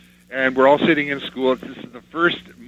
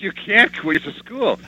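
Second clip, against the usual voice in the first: retold mother's objection; 7 semitones higher, slower than usual. Mother scolding child in retold story.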